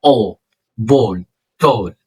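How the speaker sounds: ball and tall with a full rounded aw, slowly; deep full o. Shown as a demonstration. Teacher showing heavy aw in ball and tall. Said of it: "ل O مفخمة".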